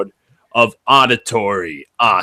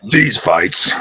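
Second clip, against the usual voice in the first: these fights in a slightly louder manly voice; masculine tone; 3 dB louder than usual. Shown as a demonstration. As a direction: beef up these fights a touch. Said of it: "a little bit more of a masculine tone".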